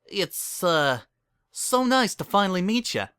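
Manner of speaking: mildly voiced nervous stammer. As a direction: stammer out a greeting in fear. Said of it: "Bon Bon stammered".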